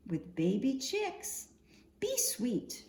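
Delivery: plain reading voice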